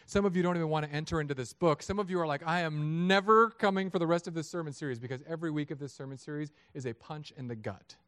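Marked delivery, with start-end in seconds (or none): 2.5-5.0 high, loud mock protest; indignant churchgoer putting a foot down; adamant refusal. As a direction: dig in and refuse, voice raised. Voices defiant listeners refusing to come.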